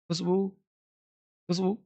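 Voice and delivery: खुशबू repeated, बू held long; long u demo. Shown as a demonstration. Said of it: "लेकिन बोला खुशबू". (खुशबू, बू लंबा खींचकर)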